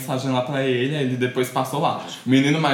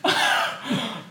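high wheezy laugh; 11 semitones higher than usual. Real, not acted. Joking to friends about a random fake name, high wheezy laugh. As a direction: laugh mostly on breath, pitched high.

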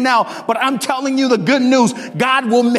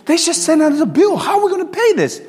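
mock distressed wife; 7 semitones higher than usual. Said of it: "she just started bawling".

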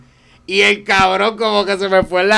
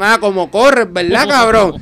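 taunting gamer jeer, a bit louder; trying to sound mocking; 5 semitones higher, 6 dB louder than usual. Retelling how he taunted the rival player, mocking jeer. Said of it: "tratando de hacerme el burlón".

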